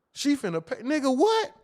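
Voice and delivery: ordinary talk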